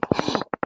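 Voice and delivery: sharp mock gasp of shock. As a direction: imitate someone gasping at a hit. Retells opponent's shocked reaction to being hit.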